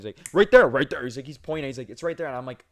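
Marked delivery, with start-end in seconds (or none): 0.3-1.0 loud, higher angry jab at the shelf; angry customer. Retold or imitated. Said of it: "angry at this point". 1.9-2.3 angry customer. Retold or imitated. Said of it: "angry at this point".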